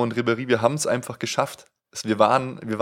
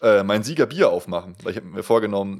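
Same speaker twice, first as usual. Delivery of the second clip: normal talking voice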